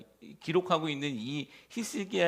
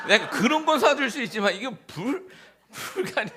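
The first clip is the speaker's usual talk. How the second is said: laughing disbelief; 9 semitones higher, 6 dB louder than usual. Real, not acted. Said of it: "이거 말도 안 되는 거잖아요".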